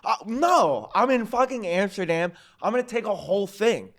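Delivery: high-pitched, worked-up mock indignation; defiant justification. Comic justifies overdoing mushrooms in Amsterdam, indignant. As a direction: argue your case with pitch jumping up, then easing. Acting out his defiant reasoning.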